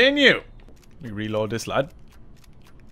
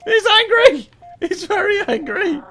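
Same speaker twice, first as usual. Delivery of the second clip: very high, alarmed voice; panicked squeal; 10 semitones higher than usual. Real, not acted. Gamer sees the enemy is angry, high-pitched panic.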